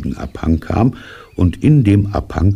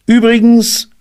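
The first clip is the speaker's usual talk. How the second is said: very high, louder rabbit voice; rabbit character; 8 dB louder than usual. A narrator's reading. Rabbit interrupts bear's hinting, high rabbit voice. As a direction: pipe up in a high voice. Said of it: "sagte Kaninchen".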